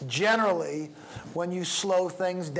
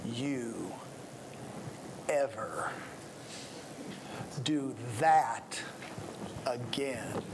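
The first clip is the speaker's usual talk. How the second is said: slow, low near-whisper threat; father quietly threatening his son, low and slow; low menacing threat; 6 semitones lower, 9 dB quieter, slower than usual. Shown as a demonstration. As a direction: drop it down, deadly serious. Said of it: "something like this".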